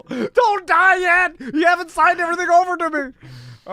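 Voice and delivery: hammed-up desperate yelling; mock screaming. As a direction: scream desperately at dying grandpa. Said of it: "You're screaming at your grandpa".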